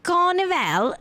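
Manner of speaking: mock British "Carnival" with the r dropped; playful British take on "Carnival"; British accent. Shown as a demonstration. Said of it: "How do you say that in a British accent?".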